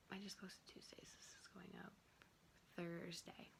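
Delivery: hushed aside; whispering. Real, not acted. Aside about upload schedule.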